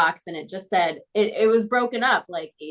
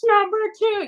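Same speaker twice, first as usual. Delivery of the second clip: eager answer; 12 semitones higher than usual. Retold or imitated. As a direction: call it out brightly, sure you're right. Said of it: "And I, and I was like".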